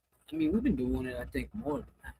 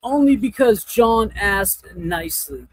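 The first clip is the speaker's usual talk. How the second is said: loud teasing; 3 semitones higher, 11 dB louder than usual. Real, not acted. Teasing reply to a chat.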